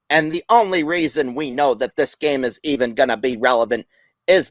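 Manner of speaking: swooping, deliberate sportscaster cadence; Howard Cosell impersonation. Acted. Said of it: "I'm gonna do another impersonation".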